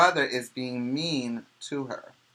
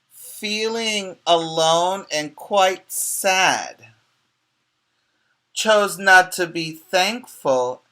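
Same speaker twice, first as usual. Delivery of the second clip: slow, louder sing-song storybook read; reading to children; 3 semitones higher, 7 dB louder, slower than usual. Acted. Teacher reading Emily's story to the class, slow and sing-song. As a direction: draw out each line like a picture book.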